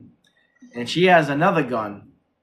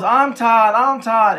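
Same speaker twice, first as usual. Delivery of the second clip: high, loud imitated shouting; mock yell; 8 semitones higher, 7 dB louder, much slower than usual. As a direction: imitate the mother yelling it desperately. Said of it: "they're both yelling".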